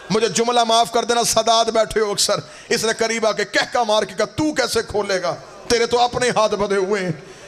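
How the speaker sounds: high, chanted, grieving sermon at full force; sung mourning sermon, pitched high; lamenting majlis preaching. Acted. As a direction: wail the tragedy of Karbala to the mourners.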